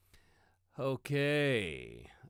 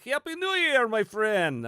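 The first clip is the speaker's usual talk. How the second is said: loud, high-pitched attempt at a Greek accent; uncertain Greek accent; 11 semitones higher, 9 dB louder, slower than usual. Greeting a viewer in Greece for New Year, makeshift Greek accent. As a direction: belt out a hammed-up Greek accent. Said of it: "Is, is that a Greek accent?".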